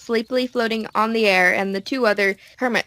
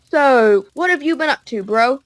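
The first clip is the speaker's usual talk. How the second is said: character voice; 4 semitones higher, 5 dB louder than usual. Acted. Awkward small talk.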